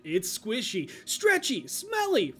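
put-on loud, high, hyped kids commercial announcer; nineties toy ad voice. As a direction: sell it like a radical nineties toy ad.

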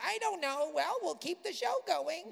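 high-pitched pretend puzzlement; mock oblivious. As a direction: play clueless, brushing off the horror. Mock oblivious hosts during imagined haunting.

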